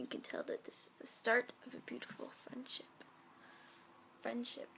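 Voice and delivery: soft breathy whisper to close; whispered ending. Acted. Closing the fanfic reading, whispered. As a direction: whisper the last line. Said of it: "whispered ending".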